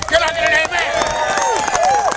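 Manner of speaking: drawn-out amen call. Real, not acted. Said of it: "call for response".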